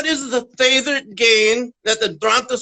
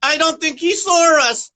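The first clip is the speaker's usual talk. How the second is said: punchline; 7 semitones higher than usual. Acted. Punchline of dinosaur joke.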